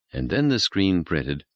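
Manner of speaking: ordinary delivery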